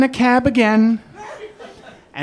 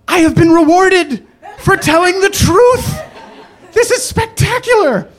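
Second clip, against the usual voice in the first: higher, laughing ironic delight; mock elation; 9 semitones higher, 4 dB louder than usual. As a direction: sell the fake triumph through a laugh. Ironic joy at being rewarded.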